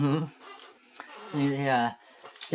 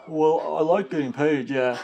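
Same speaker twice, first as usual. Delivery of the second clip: dopey guard voice; 3 semitones higher, 7 dB louder than usual. Acted.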